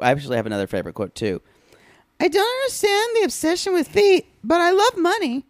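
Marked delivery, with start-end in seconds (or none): 2.2-5.4 very high-pitched put-on voice; quoting a woman. Host reading out her favorite quote, high mimicking voice. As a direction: imitate her in a squeaky falsetto. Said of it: "she also says, this is my favorite quote".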